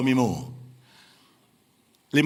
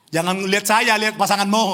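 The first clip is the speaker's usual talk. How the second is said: ordinary talk, nothing marked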